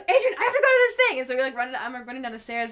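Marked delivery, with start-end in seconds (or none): 0.0-1.2 urgent rush. Retold or imitated. Urgent retold plea to friend.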